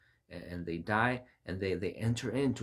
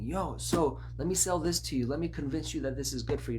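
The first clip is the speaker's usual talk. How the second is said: lightly voiced pushy sales pitch, higher and quicker; salesman's pitch; 6 semitones higher, 3 dB louder, faster than usual. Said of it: "don't have people that are saying".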